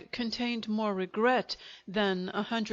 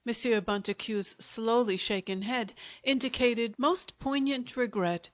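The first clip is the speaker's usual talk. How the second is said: ordinary delivery, nothing marked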